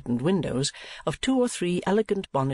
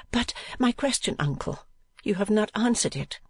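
put-on higher-pitched young woman's voice; higher female character voice; questioning niece; 8 semitones higher than usual. A narrator's reading. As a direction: press the question timidly, pitched high.

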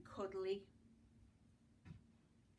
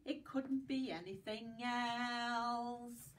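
slow; 2 semitones higher, much slower than usual. Shown as a demonstration. Rhyme closing line.

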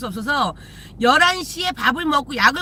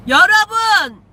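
calling out; 5 semitones higher, 7 dB louder, much slower than usual. Real, not acted. Scolding chat viewers.

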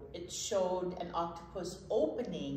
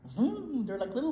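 excited; 9 semitones higher, 7 dB louder than usual. Acted. Excited motor sound for kids.

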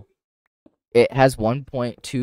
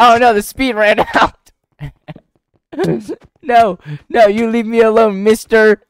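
higher, louder giggly protest; playful fleeing; 7 semitones higher, 8 dB louder than usual. Pretend. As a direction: squeal while running away.

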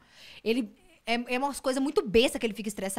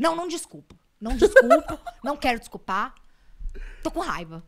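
stubborn refusal, laughing midway; mock angry refusal; 4 semitones higher, 5 dB louder than usual. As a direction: refuse the apology in playful anger. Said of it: "com sangue fervendo". (recusa birrenta, fingindo raiva)

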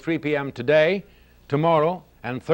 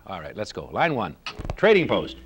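normal talking voice

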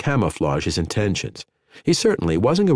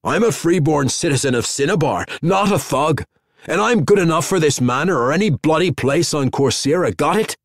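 rasping, higher and pushy; angry rasp; 7 semitones higher than usual. A narrator's reading. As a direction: bristle with offended pride. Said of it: "rasped like a crosscut saw".

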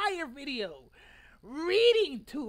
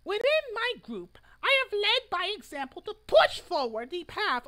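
proclamation read; 4 semitones higher, 4 dB louder than usual. Acted. Reads his protest manifesto aloud.

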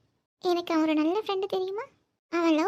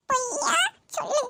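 put-on child voice; 7 semitones higher than usual. Voicing child.

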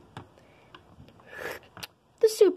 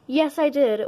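ordinary delivery

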